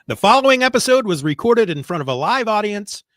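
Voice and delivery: high, loud show-opening announcement; announcer voice. Acted. Host opening a recorded episode, announcer voice. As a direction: project it like a studio announcer.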